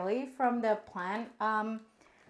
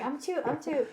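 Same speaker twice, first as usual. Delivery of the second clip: ordinary talk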